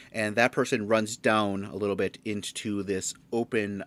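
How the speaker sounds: usual speaking voice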